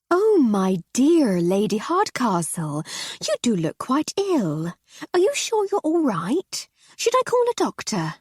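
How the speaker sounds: high, slow, gushing society lady; fussing hostess. Acted. Mrs Seddon's fussing voice.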